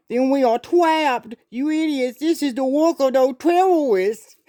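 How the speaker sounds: put-on high lisping baby voice; baby-talk lisp. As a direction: whine it like a big baby. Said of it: "He has that lisp".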